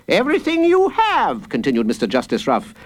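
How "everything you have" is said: judge calling out. Acted. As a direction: pitch it high and leave it hanging. Judge leads the crowd.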